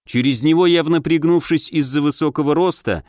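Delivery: ordinary delivery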